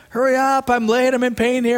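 high-pitched impatient complaint; impatient customer. Acted. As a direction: act out a customer in a rush.

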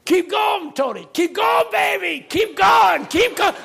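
high, full-throated cheering shout; yelling. Retold or imitated. Men standing up in church to preacher, yelling. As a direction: holler him onward, loud and insistent. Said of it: "they stand up and yell at you".

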